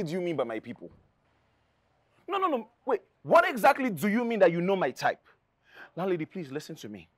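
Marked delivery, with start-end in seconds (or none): 2.3-7.1 high, loud, furious confrontation; angry. Acted. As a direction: lay into her, outraged. Tenant angrily confronting landlady.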